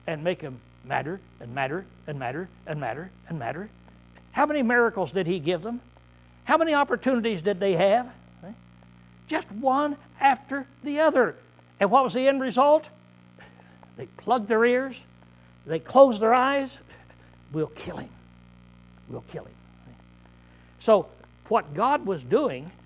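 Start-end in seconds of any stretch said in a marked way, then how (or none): 0.1-3.7 low, soft, measured "madder" each time; subdued repetition. Real, not acted. Repeats the list, subdued. 17.5-19.5 low, soft murderous resolve; hushed plotting. Acted. Voices the enemies plotting.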